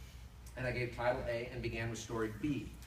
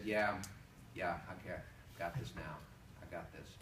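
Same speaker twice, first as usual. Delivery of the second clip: low, soft calm self-assurance; a teen before his first call, calm and quiet; 9 semitones lower, 8 dB quieter than usual. Retold or imitated. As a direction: talk yourself down, quietly. Calm rehearsed confidence.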